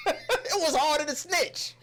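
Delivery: high, drawn-out cry through laughter; slow, laughing high-pitched punchline; laughing exclamation. Real, not acted. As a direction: crack up while hollering it. Laughing while repeating the joke.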